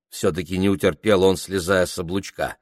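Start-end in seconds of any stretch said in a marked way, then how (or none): none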